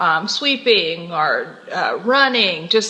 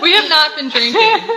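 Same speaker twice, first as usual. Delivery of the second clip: laughing; 7 semitones higher, 5 dB louder than usual. Real, not acted. Giggly hosts joking on air.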